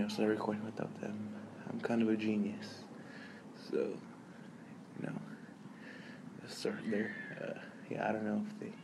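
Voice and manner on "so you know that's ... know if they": breathy near-silent whisper. Real, not acted. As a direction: whisper so people nearby won't hear. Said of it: "if they hear me whispering".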